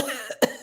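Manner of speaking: a high, worn-out cough; wolf cough. A narrator's reading. The tired wolf coughs after blowing, worn out. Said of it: "coughed and wheezed".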